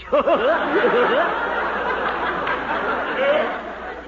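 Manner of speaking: a big rising belly laugh at his own joke; hearty laugh. Acted. Babysitter laughing at his own cheeky line to the mother, hearty laugh. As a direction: laugh it up, pleased with himself.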